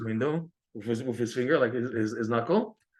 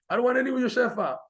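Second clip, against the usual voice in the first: indignant refusal; 11 semitones higher, faster than usual. Acted. Rebbe repeats refusal, lifting coat.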